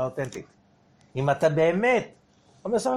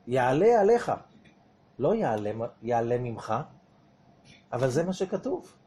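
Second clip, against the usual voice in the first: ordinary talk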